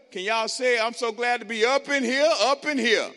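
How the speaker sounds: loud, high sermon delivery; preaching. Acted. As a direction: proclaim the message to the crowd.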